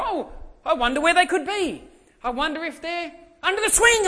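high, loud mock-wondering voice; hammed-up searching. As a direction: pretend to hunt for hiding kids. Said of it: "I'll play along with them".

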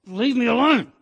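high, loud cry to be left alone; angry shout. Retold or imitated. Yelling at God to leave him alone, loud and high.

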